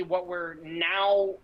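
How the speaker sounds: ordinary delivery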